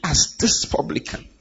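scornful, hushed "As this publican"; contemptuous whisper. Acted. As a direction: sneer it under the breath at the sinner. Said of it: "He pointed to them".

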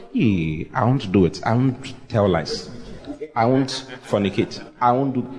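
low, dogged self-talk; imitated rule-keeper. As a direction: mimic someone grimly vowing to behave. Acts believer straining to obey rules.

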